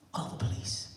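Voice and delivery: ordinary delivery, nothing marked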